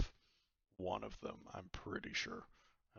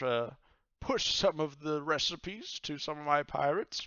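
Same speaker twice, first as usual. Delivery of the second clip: high booming pirate captain, hearty and welcoming; pirate god voice; 6 semitones higher, 4 dB louder than usual. Acted. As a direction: play the jolly pirate god inviting him over.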